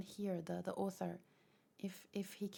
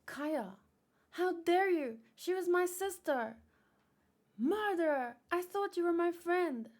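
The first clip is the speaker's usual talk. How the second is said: put-on higher and louder, a hurt girl's accusation; furious betrayed girl; 8 semitones higher, 6 dB louder than usual. Girl screaming at her former friend, furious.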